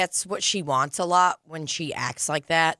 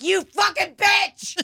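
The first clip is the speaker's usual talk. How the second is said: much higher, loud, slowed hammed-up yell; mock furious shout; 14 semitones higher, 4 dB louder, slower than usual. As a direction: explode in a joking rage. Mock outburst at her kid.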